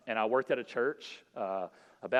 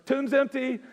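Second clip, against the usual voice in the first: higher and louder shout across the cafeteria; calling out; 11 semitones higher, 6 dB louder, slower than usual. Retold or imitated. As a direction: hail him from the booth. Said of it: "look over".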